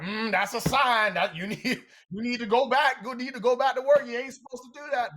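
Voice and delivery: high, loud and laughing preachy voice; spiritual preacher voice. Retold or imitated. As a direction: play the know-it-all spiritual friend. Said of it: "they were like".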